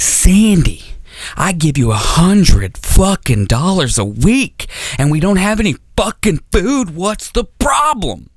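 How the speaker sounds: slow, rising rant climbing to a high pitch; hammed-up exasperation, leaning on each curse; acted mad dad. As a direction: complain like an outraged father. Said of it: "my dad would get really fucking mad".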